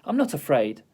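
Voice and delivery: plain reading voice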